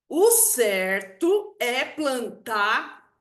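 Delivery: slow, hammered "O certo é plantar", each word held apart; emphatic decree. Retold or imitated. Dad decreeing to his daughter where to plant, slow and emphatic. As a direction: stretch "certo" and "plantar" like a final ruling. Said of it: "ele enfatiza aquilo".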